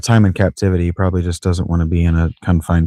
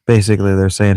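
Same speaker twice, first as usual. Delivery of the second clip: ordinary talk, nothing marked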